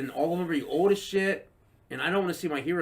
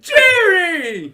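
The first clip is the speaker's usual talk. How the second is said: high, loud wailing Jerry; Uncle Leo call; 13 dB louder than usual. Retold or imitated. Uncle Leo calling to Jerry, loud wail. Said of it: "He's like".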